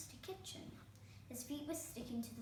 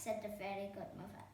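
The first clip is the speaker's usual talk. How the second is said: ordinary delivery, nothing marked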